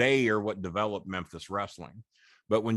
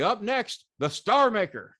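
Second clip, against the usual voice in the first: mock hype announcer, pitched higher; 11 semitones higher, 5 dB louder than usual. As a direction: sell it like a live TV tease. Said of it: "they say".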